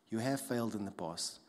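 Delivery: ordinary talk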